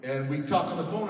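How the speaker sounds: ordinary talk, nothing marked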